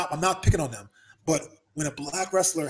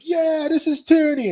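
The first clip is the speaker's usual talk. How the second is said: mocking protester; 12 semitones higher, 9 dB louder than usual. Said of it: "and he's like".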